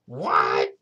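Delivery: low, loud, startled what; granny barks out her surprise; deep startled granny cry; shocked cry. Acted. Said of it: "cried Granny".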